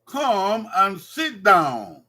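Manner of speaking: "come and sit down" drawn out, "sit" stressed; slow classroom model, leaning on "sit"; slow teaching model. Shown as a demonstration. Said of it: "Number three".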